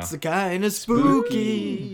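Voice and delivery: slow, drawn-out spooky tone. Pretend. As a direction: stretch it out like a ghost story. Halloween series joke.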